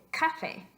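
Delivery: "café" stressed on CA; misplaced stress. Shown as a demonstration. Teacher showing what the rule would make, CA stressed in café. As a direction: hit the first syllable CA in "café". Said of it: "cae en la penúltima sílaba".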